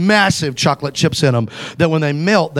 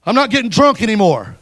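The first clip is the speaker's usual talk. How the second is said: higher, louder blurted confession; mock nervous outburst, louder and higher; 5 semitones higher, 5 dB louder than usual. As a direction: blurt it out in a panic. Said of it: "I got real nervous".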